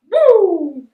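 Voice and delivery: very high, very loud whoop of excitement; real ecstatic yell; wild whoop. Real, not acted. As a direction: let out a huge hyped whoop. Excited outburst recapping.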